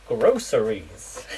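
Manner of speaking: slow drawn-out American groceries. Shown as a demonstration. To viewers in America, an American-style groceries. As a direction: stretch it like an American would. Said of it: "You guys in America say groceries".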